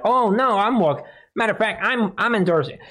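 high, lightly mocking quote voice; mock politician quote. Mimicking a politician rushing to endorse, high mock protest. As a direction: lift the pitch for a mock backpedal. Acts out a politician's about-turn.

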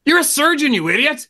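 high, incredulous scolding; mock scolding. Speaker scolding the smoking surgeon, incredulous. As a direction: scold him like he's lost his mind.

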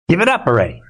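laughing, rushed taunt; chuckling give-up jab. Real, not acted. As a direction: laugh it off at them fast.

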